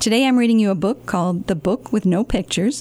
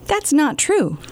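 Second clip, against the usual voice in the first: ordinary delivery, nothing marked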